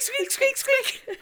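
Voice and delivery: very high-pitched squeaks; imitated squeaky shoe; squeaky-shoe noises. Teasing friend about squeaky shoes, high squeaking. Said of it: "squeaky shoes on you".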